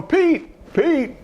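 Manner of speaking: high-pitched mock call of ushers; imitated yelling. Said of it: "all the ushers are yelling".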